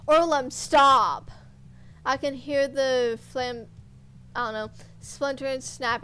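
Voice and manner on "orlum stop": loud, slow pleading shout; desperate shout. Acted. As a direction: beg him to stop at full volume. Friend begs torturer to stop.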